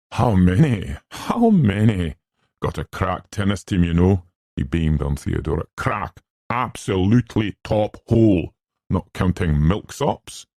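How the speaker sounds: pleased, self-important schoolman; boastful schoolmaster. Acted. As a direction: boast proudly to an admiring guest. Arthur boasts, beaming, of his school.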